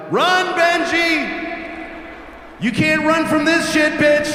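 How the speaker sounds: high, loud, drawn-out taunting yell; hammed-up triumphant bellow; gloating shout. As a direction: holler after someone running away. Mock calling after fleeing child.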